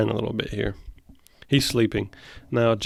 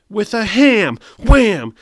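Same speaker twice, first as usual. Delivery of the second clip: higher, louder burst on "Wham"; thrilled reaction shout; excited yell; 9 semitones higher, 9 dB louder, slower than usual. Real, not acted. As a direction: slam the word Wham out loud. Excited at ham punchline.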